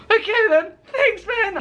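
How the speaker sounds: very high, unconvinced singsong thanks; mock thanks. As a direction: accept useless advice in a high, strained voice. Brushes off brother's unhelpful advice.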